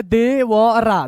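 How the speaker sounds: very slow, separated syllables. Shown as a demonstration. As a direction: spell it out sound by sound. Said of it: "एक एक अक्षर आपको तोड़ के बता रहे हैं".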